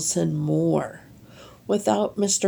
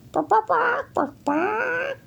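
1 barking chicken. A narrator's reading.

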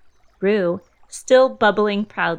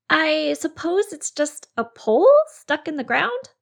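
lightly voiced Rabbit, a bit higher; 8 semitones higher than usual. A narrator's reading. Rabbit guessing to Christopher Robin, lightly voiced. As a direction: Rabbit musing, slightly lifted voice.

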